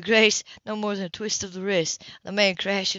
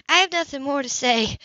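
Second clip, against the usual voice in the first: higher, louder cold dismissal; cold and dismissive; 7 semitones higher, 5 dB louder than usual. Acted. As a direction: dismiss him coldly. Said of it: "Her voice was cold and dismissive".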